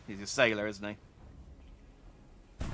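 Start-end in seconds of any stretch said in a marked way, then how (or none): none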